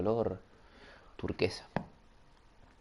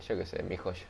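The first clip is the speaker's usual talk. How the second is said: usual speaking voice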